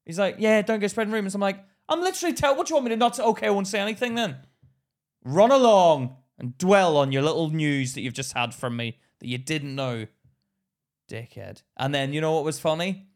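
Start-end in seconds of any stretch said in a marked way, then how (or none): 0.1-4.4 loud, high angry retort; shouting back. Retold or imitated. Employee yelling at CEO in meeting, loud. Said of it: "I was like". 5.2-11.6 loud then fading into a muttered insult; sarcastic dismissal. Retold or imitated. Employee dismissing CEO sarcastically, trailing off. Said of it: "I was like".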